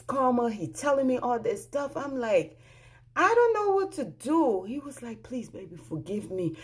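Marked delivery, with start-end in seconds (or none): 3.2-4.6 high-pitched retold protest; acting out being at wits' end; overwhelmed, voice pitched way up; exasperated helplessness. Overwhelmed by his pleas. 5.2-6.6 imitating a husband's soft plea; quiet, subdued retold begging; begging forgiveness. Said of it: "He's begging me for forgiveness".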